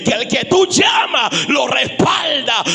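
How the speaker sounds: loud, slow, high shouted testimony; shouted prayer to God becoming sermon climax; preaching at full force. Acted. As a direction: pour it out over the congregation.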